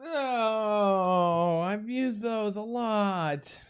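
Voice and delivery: playful fake sob; mock crying. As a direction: fake whimper over it.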